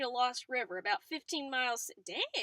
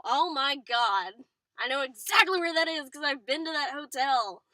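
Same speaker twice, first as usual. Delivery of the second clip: real excited outburst, louder; 8 dB louder than usual. Real, not acted. Reader recognizing the hotel, excited and louder. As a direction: blurt it out, thrilled to know the place. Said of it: "I get really excited".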